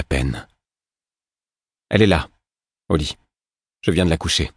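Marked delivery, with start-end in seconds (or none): 1.9-4.5 curt. A narrator's reading. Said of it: "répondit sèchement Molly".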